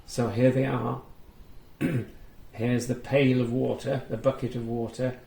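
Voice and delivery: ordinary delivery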